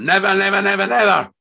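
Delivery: loud, high, furious repetition; snarled refusal. A narrator's reading. Husband refusing his wife outright, furious. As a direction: hammer each word in fury. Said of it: "Fred snarled".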